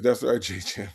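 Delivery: normal talking voice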